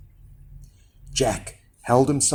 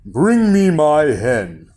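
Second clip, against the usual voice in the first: slow, commanding giant voice; 5 dB louder than usual. A narrator's reading. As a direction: order it slowly, as a giant. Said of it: "said the giant".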